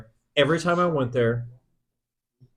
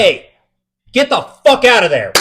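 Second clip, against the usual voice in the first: much higher and louder, fed up; angry shout; 7 semitones higher, 9 dB louder than usual. Retold or imitated. Scared guard yelling at a hidden intruder in the ducts, loud angry shout. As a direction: yell it up at the ceiling to scare them off.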